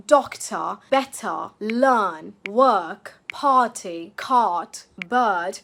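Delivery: DOCTOR, BETTER, LEARN, WORK, PARTY, CART, BIRD with silent R; non-rhotic British R. Shown as a demonstration. Teacher showing learners British R, non-rhotic. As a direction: drop the R in BIRD and CART, lengthening the vowel. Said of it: "the R at the end or after a vowel is not pronounced at all".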